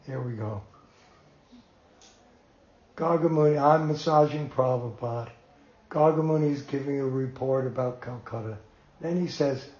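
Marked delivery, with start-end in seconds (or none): none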